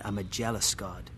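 plain reading voice

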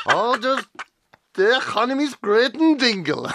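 high, loud mock Kerry accent; Jackie Healy-Rae. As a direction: imitate a tiny Kerry elder, nearly laughing. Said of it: "starting to sound like Jackie Healy-Rae".

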